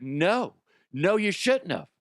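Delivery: incredulous no. Retold or imitated. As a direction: spell it out like he's dense. Answering the sheepish intern.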